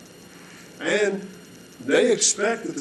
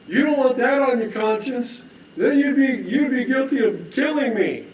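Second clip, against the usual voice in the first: ultimatum; 6 semitones higher than usual. Acted. Holder's ultimatum and reply.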